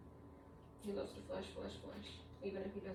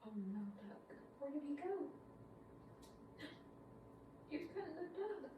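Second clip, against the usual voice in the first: soft, higher playful voice; 7 semitones higher, 8 dB quieter than usual. Real, not acted. Mother plays with toddler.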